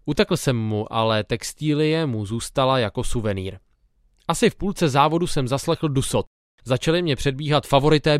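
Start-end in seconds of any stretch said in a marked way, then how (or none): none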